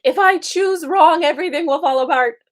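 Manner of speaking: mock-frantic catastrophizing; anxious ego voice. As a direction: play the fearful inner voice spiraling. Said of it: "the ego is gonna make us think".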